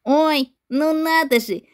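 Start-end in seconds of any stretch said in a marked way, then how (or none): none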